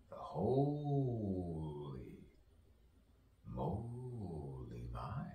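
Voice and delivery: very slow, eerie drawn-out ghost voice; ghostly drawl. Pretend. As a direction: stretch it out like a haunting spirit.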